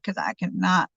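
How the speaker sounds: plain reading voice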